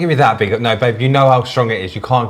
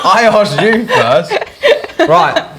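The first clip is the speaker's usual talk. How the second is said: laughing; 10 semitones higher, 5 dB louder, much slower than usual. Real, not acted. Laughing protest.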